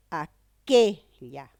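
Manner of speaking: "aquella" broken up, with a loud, long QUE; stress on middle syllable. Shown as a demonstration. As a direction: stretch and punch the QUE in "aquella", very slowly. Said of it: "es la sílaba tónica". ("aquella" lento, recalcando fuerte la sílaba QUE)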